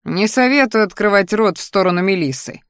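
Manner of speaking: plain reading voice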